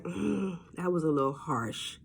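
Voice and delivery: gentle self-reproach; quiet self check. Retold or imitated. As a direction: murmur it to yourself as you realize. Inner self check, muttered.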